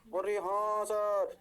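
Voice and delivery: slow drawn-out mock student chorus; mock student reply. Teacher voicing the class's lazy reply, drawn out slow. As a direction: stretch it out like a sleepy class answering.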